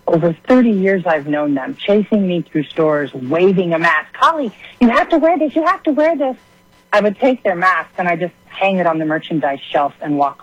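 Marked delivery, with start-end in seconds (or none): 4.1-6.4 much higher, nagging singsong mimicry; mocking nag. Caller mimics old schoolmates chasing her with masks, high mocking nag. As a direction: parody the pushy acquaintances chasing her.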